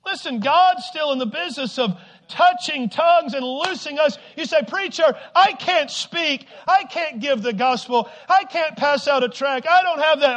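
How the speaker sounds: high, loud urgent exhortation; shouted preaching. Real, not acted. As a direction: call the church to attention at full voice. Preaching at full force.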